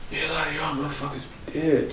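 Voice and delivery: quiet, ominous aside; hushed threat. Acted. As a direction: drop the volume for a mock threat. Veiled threat in imagined retort.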